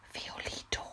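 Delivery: a frightened whisper of the name. A narrator's reading. Mimi hears someone at the door, whispers in fright. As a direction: breathe the name in fear. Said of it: "flüsterte Mimi".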